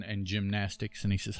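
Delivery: normal talking voice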